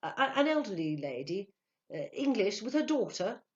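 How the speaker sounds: stammering, halting description; trying to think. A narrator's reading. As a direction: grope for each detail. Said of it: "He tried to think".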